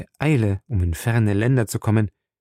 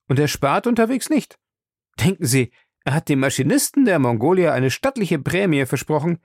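higher-pitched, gossiping servant; chatty Passepartout; 6 semitones higher than usual. Acted. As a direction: boast eagerly about your master's money. Voices Passepartout gossiping about master.

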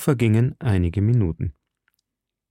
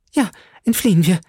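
high, fast, louder frightened echo; a terrified young woman echoing; panicked agreement; 8 semitones higher, 4 dB louder, faster than usual. A narrator's reading. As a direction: agree in breathless terror. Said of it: "da sie der Schrecken an ihren Platz fesselte".

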